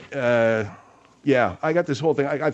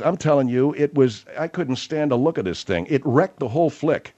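ordinary delivery

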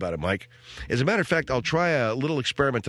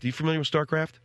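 ordinary talk, nothing marked

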